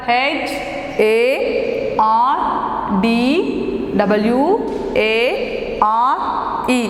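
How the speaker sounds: letters spelled out slowly one by one; slow spelling. Shown as a demonstration. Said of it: "What is the spelling for hardware?".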